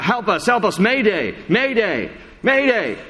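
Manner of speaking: high frantic distress cry; panicked mayday call. Retold or imitated. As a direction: call for help as if sinking. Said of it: "guy on the radio who's saying".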